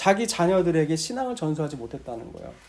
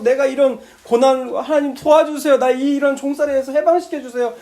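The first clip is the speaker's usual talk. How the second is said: higher, louder pleading prayer; desperate plea; 6 semitones higher, 6 dB louder than usual. Retold or imitated. Enslaved Israelites crying out to God, pleading loudly. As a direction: beg to be set free.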